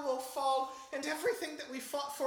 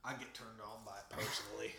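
much lower, soft seductive voice; deep sultry voice; 11 dB quieter than usual. Acted. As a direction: purr it low. Deep sultry Lincoln fan.

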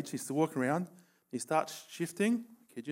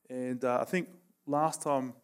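usual speaking voice

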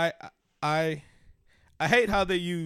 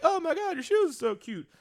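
much higher, gushing mock squeal; a friend mocking shoe admirers with a gushing squeal; 12 semitones higher than usual. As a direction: gush over cute shoes like a fan. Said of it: "I might like".

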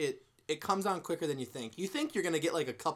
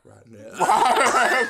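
laughing; 7 semitones higher, 11 dB louder, much slower than usual. Real, not acted. Tasting the bad bean.

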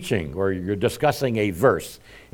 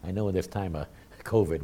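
ordinary talk

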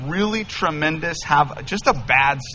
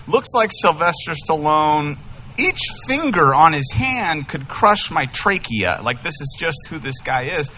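ordinary talk

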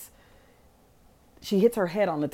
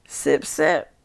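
high, slow and louder, teasing; playfully mocking a sly sneaky sip; smug sipping; 4 semitones higher, 4 dB louder, slower than usual. Gina sneaks a sip while everyone's cups are knocked away, smug and teasing. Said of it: "Gina was like".

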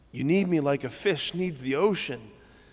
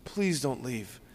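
soft, slow pleading; begging father; 4 dB quieter, slower than usual. Retold or imitated. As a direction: plead quietly with a son who is leaving. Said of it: "imagine him begging, pleading".

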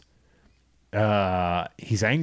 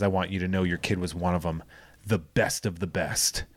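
softer, lower, fast earnest line; earnest quiet Barry; 5 semitones lower, 6 dB quieter than usual. Retold or imitated. Host imitating Barry's quiet pledge to his dad. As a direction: mutter it like a vow.

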